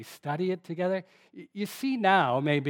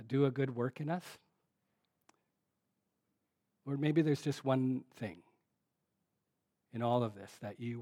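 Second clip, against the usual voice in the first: soft, hushed prayer growing quieter and quieter; pastoral prayer; 4 semitones lower, 7 dB quieter than usual. Acted. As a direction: pray gently over the congregation, fading to almost nothing. Pastor leads congregation in prayer.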